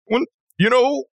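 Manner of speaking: very high, strained fake sob breaking into laughter; badly faked crying; forced crying. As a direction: try to squeeze out tears and laugh instead. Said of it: "I'm trying to force myself to cry".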